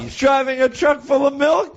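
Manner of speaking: high, slow put-on trucker drawl; trucker caller voice. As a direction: play the daily caller hauling milk. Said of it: "today he wants to talk about".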